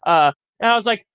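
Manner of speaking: usual speaking voice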